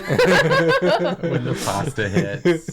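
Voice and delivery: laughing. Real, not acted. Laughing, very slow, far.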